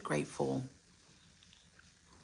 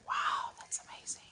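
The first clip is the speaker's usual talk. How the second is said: whisper; 7 dB quieter than usual. Retold or imitated. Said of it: "I was just like".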